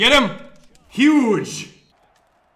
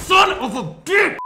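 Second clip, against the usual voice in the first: high, loud, slow outburst; furious yell; 14 semitones higher, 7 dB louder, slower than usual. Real, not acted. Gamer yelling after losing the game. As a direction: explode at the screen.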